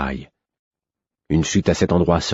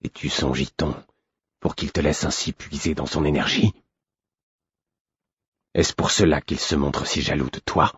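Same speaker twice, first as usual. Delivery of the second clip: venomous hatred; 2 semitones lower, 5 dB quieter than usual. Acted. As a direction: sneer each word quietly. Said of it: "dans son ton perçait une haine fielleuse".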